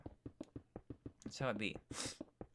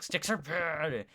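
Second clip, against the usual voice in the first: slow, higher mock outburst; mock streamer rant; 5 semitones higher, 5 dB louder, much slower than usual. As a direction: parody a streamer flipping out. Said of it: "anyone who streams ... is always like".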